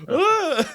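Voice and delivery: high, loud laughing shriek of shock; startled shriek. Retold or imitated. Girlfriend learning the wine cost, a laughing shriek. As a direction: shriek like you just saw the price tag.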